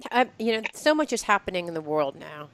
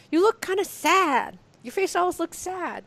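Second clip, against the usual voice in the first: teasing friend; 9 semitones higher than usual. Retold or imitated. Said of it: "And you can say".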